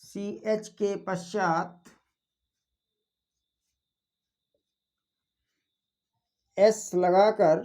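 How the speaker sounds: word-by-word dictation with long pauses; slow dictation. Acted. Teacher giving students the exception rule, slow dictation. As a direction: dictate slowly, leaving time to write.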